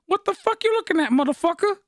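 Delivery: high, put-on aggressive voice; hammed-up street-tough retort; mock tough comeback. Imitating Lester's aggressive comeback.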